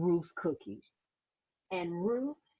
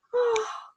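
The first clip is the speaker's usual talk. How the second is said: happy sigh; 14 semitones higher, 3 dB louder than usual. Acted.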